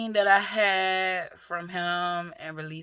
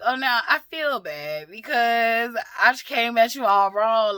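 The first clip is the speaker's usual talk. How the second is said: high, loud imitation of a daughter apologizing; apologetic daughter; 7 semitones higher, 9 dB louder than usual. Daughter who came at her mother wrong, apologetic. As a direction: voice the daughter's regret, pitched up and emphatic.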